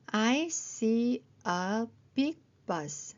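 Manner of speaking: very slow, each word separate; slow model reading. Shown as a demonstration. Teacher checking phonics with short sentences, slow model reading. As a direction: read it out slowly for beginners.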